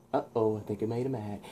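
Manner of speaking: soft, understated worried aside; hushed uh-oh. Acted. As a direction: say it under your breath to yourself. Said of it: "and he's like".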